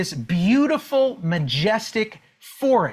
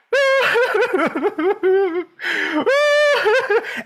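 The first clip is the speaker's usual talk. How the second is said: laughing; 11 semitones higher, 3 dB louder than usual. Real, not acted. Laughs at his own story.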